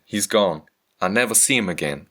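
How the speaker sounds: normal talking voice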